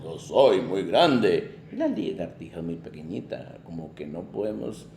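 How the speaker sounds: a big dragon puzzling aloud. Acted. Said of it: "dijo el dragón".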